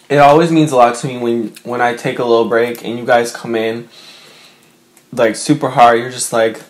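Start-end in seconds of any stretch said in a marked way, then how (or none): none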